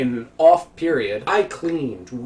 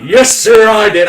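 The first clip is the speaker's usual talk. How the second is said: much louder, higher proud answer; proud loud reply; 8 semitones higher, 13 dB louder, slower than usual. Retold or imitated. Worker proudly admitting the insult to the boss, loud. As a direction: own it loudly and proudly. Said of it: "I just went".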